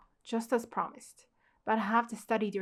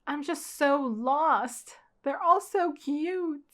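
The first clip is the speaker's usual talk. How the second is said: slow, higher and louder swooning; gushing; 5 semitones higher, 7 dB louder, much slower than usual. Real, not acted. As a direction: melt over how cute they are. Overwhelmed by cute yukata.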